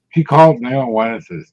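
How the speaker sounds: ordinary talk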